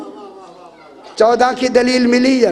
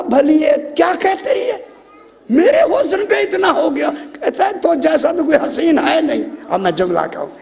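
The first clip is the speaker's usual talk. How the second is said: full-force preaching; 4 semitones higher than usual. Acted.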